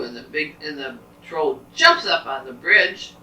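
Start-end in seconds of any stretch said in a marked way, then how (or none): none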